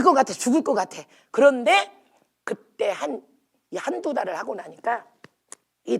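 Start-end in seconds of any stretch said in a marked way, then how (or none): none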